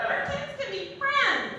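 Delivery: very high delighted squeal; gushing friend. Retold or imitated. Friend misunderstanding the pregnancy scare, high gush. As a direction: gush it like thrilled news.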